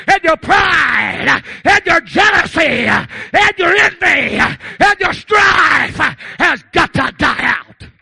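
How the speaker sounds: high, loud, slow pulpit shout; stretched, shouted revival cadence; full-force preaching. Real, not acted. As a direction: drive each phrase home at the congregation.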